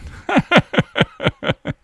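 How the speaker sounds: a high, breathy burst of laughter; airy amused giggle. Real, not acted. Amused by a video.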